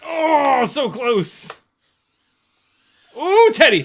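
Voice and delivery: thrilled at a near miss; excited. Real, not acted.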